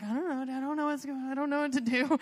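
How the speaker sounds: lightly imitated helpless fluster; helpless husband. Wife imitating husband told of her meltdown, mock helpless. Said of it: "I told Nate, and he's like".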